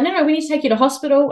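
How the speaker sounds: lightly acted urgent insisting; insistent, retold. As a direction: press the point as a retold paramedic. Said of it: "they were pretty insistent".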